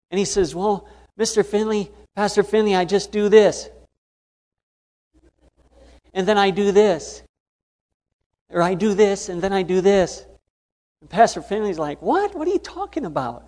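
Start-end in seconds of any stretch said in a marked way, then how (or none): 0.5-10.2 higher, lively small man's voice; Ramon's voice. Retold or imitated. Ramon showing Pastor Finley his method, higher voice. 12.0-13.4 sharp high yelp of confusion; baffled what. Retold or imitated. Pastor Finley baffled by Ramon's answer, high surprised cry.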